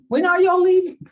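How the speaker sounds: high, loud whining question; impatient puppy voice. Pretend. The family puppy asking the owners, loud high whine.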